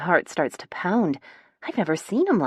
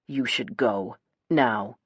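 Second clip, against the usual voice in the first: low, measured male command; cold order; 5 semitones lower, slower than usual. A narrator's reading. Chase shields Gemma from Parker, cold. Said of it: "his green eyes cold on Parker".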